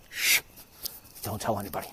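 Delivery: soft, low, confidential; mock-secret hush. Host about to share a rumor, soft mock-secret hush. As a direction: hush them, then confide it softly.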